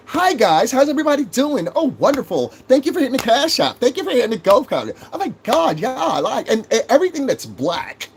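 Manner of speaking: sweet as pie, mocking the friendly streamer; mock polite podcaster. Mocking how viewers want him to talk, high fake-sweet podcaster voice. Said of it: "if you want me to talk like".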